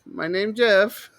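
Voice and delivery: put-on slow, high drunken slur; a hint of a drunk man; drunk guy voice. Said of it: "when he's drunk, he goes".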